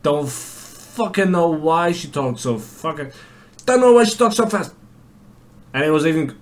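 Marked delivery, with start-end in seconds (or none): 3.7-4.7 high, loud, rapid-fire mimicry; imitating fast talker. Classmate imitated talking too fast, high rapid gabble. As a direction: race through it like a girl in a rush. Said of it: "she's even fucking quicker than that".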